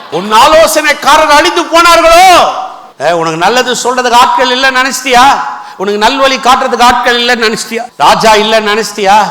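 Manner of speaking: fervent preacher demanding an answer; challenging preaching. Acted. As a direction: press each question on the congregation. Challenges congregation with questions.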